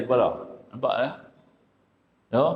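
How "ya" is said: ordinary talk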